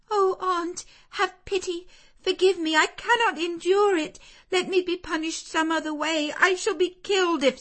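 very high, loud, desperate pleading; begging child. Acted. Jane begging her aunt not to lock her in, desperate. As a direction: beg for mercy in terror.

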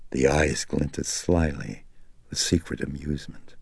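ordinary delivery

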